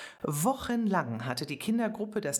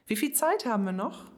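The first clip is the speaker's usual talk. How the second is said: put-on quick, high, anxious girl's question; nervous question; 7 semitones higher, 4 dB louder, faster than usual. A narrator's reading. Zoe backstage, nervously asking the time before the show. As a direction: ask with a pounding heart. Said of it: "fragte sie mit klopfendem Herzen".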